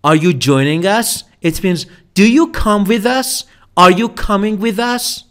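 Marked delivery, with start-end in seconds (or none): none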